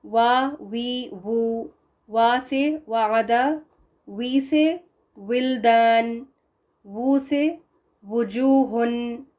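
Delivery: वा वी वू drawn out, lips rounded; slow recitation. Shown as a demonstration. Teacher slowly recites vowel examples to class. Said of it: "ज़बर ज़ेर पेश के साथ देखें".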